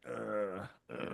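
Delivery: low, quiet "ugh" groan; muted groan of disapproval; disgusted groan. Retold or imitated. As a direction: groan softly with distaste. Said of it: "and it was like".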